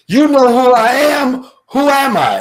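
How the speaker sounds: high, loud champ demanding recognition; Ali. Retold or imitated. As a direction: boom the question out, pitched high. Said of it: "And Ali goes".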